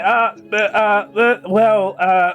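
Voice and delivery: hesitant string of false starts; mock stuttering. Game master imitating Lark stuttering along, mock hesitation. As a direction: imitate someone stuttering along, lost for words. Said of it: "sort of stutter along, just like".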